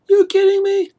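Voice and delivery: shrieked high, very loud disbelief; mock-outraged squeal at the idea of a fancy camera; incredulous. Mock disbelief at needing DSLR.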